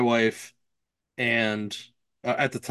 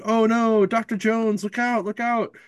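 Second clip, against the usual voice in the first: mock screaming warning; 9 semitones higher than usual. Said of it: "He's like".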